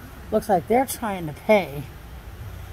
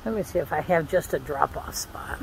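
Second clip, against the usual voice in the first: whispering; 6 semitones lower, 8 dB quieter than usual. Real, not acted. Whispering while checking in line.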